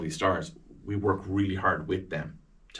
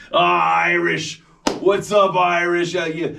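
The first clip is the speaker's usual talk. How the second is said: mock boisterous holler; high, loud, drawn-out teasing greeting; George Clooney; 9 semitones higher, 8 dB louder, slower than usual. Star hailing the Irish interviewer, hollered teasingly.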